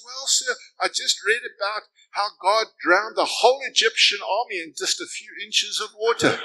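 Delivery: put-on a high, eager young hippie; excited hippie. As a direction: play the grinning hippie with the punchline. Hippie answers the old man.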